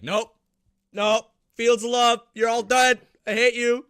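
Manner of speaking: yelling at losers in game; mock raging yell. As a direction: shout it like you are trashing the enemy team. Acting out his game rage.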